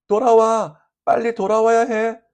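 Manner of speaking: high, loud calling out; shouted plea. A narrator's reading. Playmates calling the boy back from behind, shouting. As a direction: call someone back urgently from a distance. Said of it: "소리 지르던 그 친구들".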